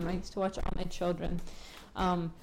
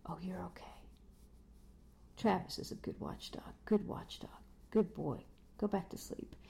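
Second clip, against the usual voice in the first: soft near-whisper to the dog; hushed aside to dog; 8 dB quieter than usual. Real, not acted. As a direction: quietly settle the dog. Soothing the dog mid-recording.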